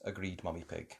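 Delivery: ordinary delivery, nothing marked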